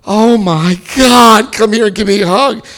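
loud, high, drawn-out mock welcome; effusive greeting. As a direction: gush loudly like a thrilled friend. Meeting members greet him effusively.

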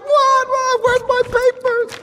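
very high mock-frightened squeak; panicked high squeal. Man facing the father he wronged, squeaking in panic. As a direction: shriek like you're about to be hit.

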